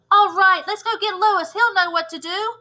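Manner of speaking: rally the class with a shout; excited shout. Acted. Mauricia rallies classmates to fetch Lewis, excited. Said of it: "shouted Mauricia".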